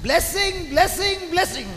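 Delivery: high, loud chant of blessing; acted crying response. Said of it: "you start crying". (அழுவது போல நடித்து உரத்த குரலில்)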